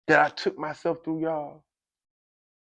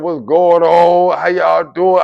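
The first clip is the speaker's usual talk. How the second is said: loud, stretched-out hello; big, happy, friendly call; chummy greeting; 3 semitones higher, 9 dB louder, much slower than usual. Retold or imitated. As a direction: greet everybody heartily, drawing it out. Retold suddenly chummy small talk.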